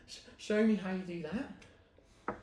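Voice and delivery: high, slow, amazed plea; awestruck, drawn-out request; awed creature. Acted. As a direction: stammer it out in high-pitched wonder. Awed creature asks to learn trick.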